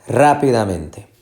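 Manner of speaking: slow rápidamente, RÁ stressed, pitch falling away; stress on the first syllable. Shown as a demonstration. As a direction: hit RÁ in rápidamente. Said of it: "La fuerza de pronunciación es aquí". (rápidamente despacio, con acento en RÁ)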